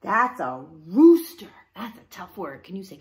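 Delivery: normal talking voice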